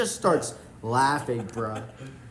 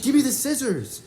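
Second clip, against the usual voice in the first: insisting louder; 11 semitones higher, 6 dB louder than usual. Retold or imitated. Asking mom again, insisting.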